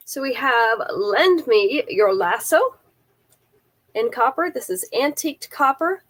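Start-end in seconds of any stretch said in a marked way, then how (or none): none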